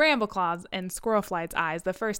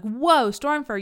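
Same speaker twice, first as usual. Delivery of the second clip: a sudden louder whoa; startled leader's mock gasp; 4 semitones higher, 6 dB louder, slower than usual. Leader startled by a fighter: a sudden whoa. Leader voiced scolding cat.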